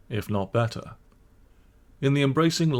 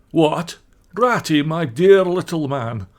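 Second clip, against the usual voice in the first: louder, slow, fond greeting; kindly surprise; 6 semitones higher, 7 dB louder, slower than usual. Acted. Badger recognises Rat and welcomes him warmly. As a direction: melt from gruff into welcome. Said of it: "in quite a different voice".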